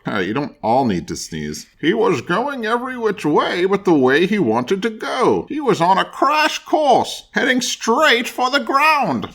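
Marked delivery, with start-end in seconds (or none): 1.8-9.3 high, tense story voice; dramatic narration. A narrator's reading. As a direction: tell it like he's falling.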